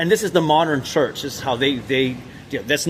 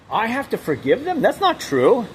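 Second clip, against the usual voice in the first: rising, higher, disbelieving "them?"; incredulous; 7 semitones higher than usual. Real, not acted. Refusing to forgive his family's murderers, incredulous rise. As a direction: throw the question back in outrage.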